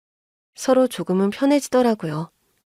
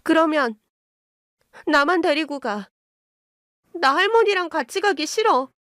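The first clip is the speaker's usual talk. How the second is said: put-on high, whiny child's plea; tearful pleading; 6 semitones higher than usual. A narrator's reading. Daughter begs Mom to take only her, tearful high plea. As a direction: plead tearfully, climbing in pitch. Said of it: "갑자기 울면서 그러는 거예요".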